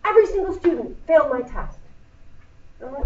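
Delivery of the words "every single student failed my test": high-pitched exaggerated complaint; mock-distressed colleague, pitched up; Mister Shelton. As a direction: imitate a teacher overdramatizing bad test results. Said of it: "he'll walk in my room and say".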